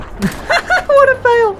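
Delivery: high laughing shout at a miss. Real, not acted. Gamer laughing at his own missed shot, loud laughter. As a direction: laugh it off loudly.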